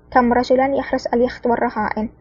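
ordinary delivery

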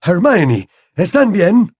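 high, anxious check on them; trembling voice. A narrator's reading. Terrified father checking on the children, trembling. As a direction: ask urgently, shaken with worry. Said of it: "Su voz sonaba temblorosa".

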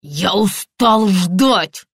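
slow, indignant colonel; drawn-out, annoyed complaint. A narrator's reading. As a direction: drag out each word in indignation. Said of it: "возмутился полковник".

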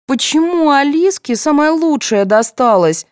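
high, slow whiny complaint; hurt child's high protest; offended. A narrator's reading. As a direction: whine out the unfairness. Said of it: "с обидой воскликнула".

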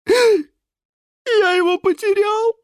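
very high, slow wailing cry; despairing sob. Acted. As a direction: wail the loss in despair. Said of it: "в отчаянии всхлипнул". (отчаянный всхлип, очень высоко)

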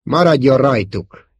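commanding. A narrator's reading. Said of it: "parancsolta Vader".

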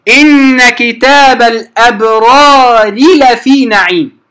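slow إن كتاب الأبرار لفي with the ra merged into the lam; slow Quranic demo of إن كتاب الأبرار لفي. Shown as a demonstration. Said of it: "مثال آخر".

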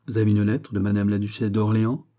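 ordinary delivery

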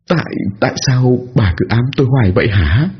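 high stammering fearful question; frightened stammer. A narrator's reading. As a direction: push the words out through fear. Narrator confronts ghost, stammering.

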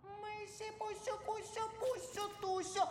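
put-on squeaky high baby voice; high baby talk. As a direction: coo it in a tiny high voice.

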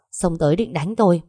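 ordinary delivery

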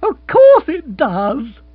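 high, loud, slow beaming agreement; buoyant. Acted. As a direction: bounce with cheer. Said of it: "said Bill buoyantly".

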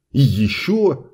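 usual speaking voice

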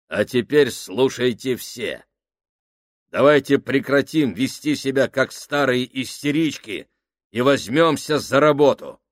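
loud, firm orders to the crew; commanding. Acted. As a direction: bark orders like a sergeant.

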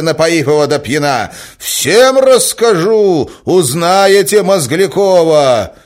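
high, very loud enraged threats; furious shouting. A narrator's reading. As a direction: shout it in a boiling rage. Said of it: "кричал он". (яростный крик с угрозой)

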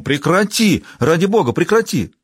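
high, urgent cry to stop; a father shouting at his daughter to stop; exclaiming. A narrator's reading. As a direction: plead with her to stop at once. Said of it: "отец воскликнул".

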